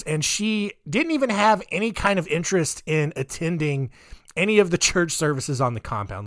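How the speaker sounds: ordinary talk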